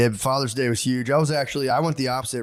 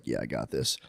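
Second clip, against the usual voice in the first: much lower, much softer confident murmur; soft assurance; 13 semitones lower, 9 dB quieter than usual. Retold or imitated. Dad rocking baby to sleep, soft low murmur. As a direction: reassure yourself gently under the breath.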